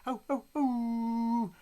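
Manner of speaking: high, drawn-out excitement; excited slow oh oh oh. Real, not acted. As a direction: stretch it out, thrilled. Excited reaction to the story.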